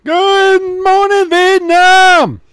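high, loud, stretched-out Good morning Vietnam; radio DJ yell. Pretend. Shouting the famous radio greeting at his cohost. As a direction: belt it like a radio DJ.

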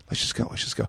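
hushed let's go; urgent whisper to leave; whispered urging. Retold or imitated. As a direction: girlfriend whispering to slip away.

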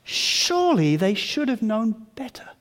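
usual speaking voice

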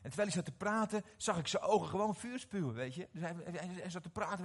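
ordinary talk

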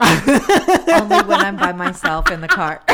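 loud slow laughing words. Real, not acted. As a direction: laugh through it.

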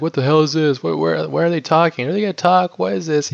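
put-on high-pitched, baffled kid-sister questions; imitating little sister. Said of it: "my little sister is like".

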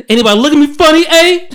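high, loud quoted tough-guy line; movie quote. Retold or imitated. Recalling a movie quote to mock a coworker, loud and high. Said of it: "What was that from Baden Fold?".

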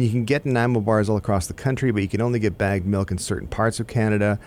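ordinary talk, nothing marked

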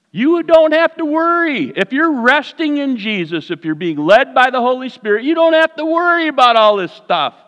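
high, loud preacher's cry over the congregation; preaching at full force. Real, not acted. Preacher urging the church not to worry, loud and high. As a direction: lift them out of worry with a rising cry.

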